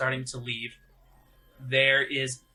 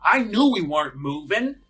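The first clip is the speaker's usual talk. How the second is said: much higher, louder whiny voice; annoyed passenger; 8 semitones higher, 9 dB louder than usual. Acted. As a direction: complain in a put-on nasal voice. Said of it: "someone go".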